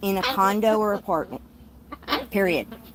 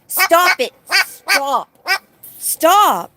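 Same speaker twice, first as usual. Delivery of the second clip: loud, high stop at the bird; loud high command to stop; sharp stop; 9 semitones higher, 9 dB louder, much slower than usual. Real, not acted. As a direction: bark the command to quit. Scolding a screaming bird.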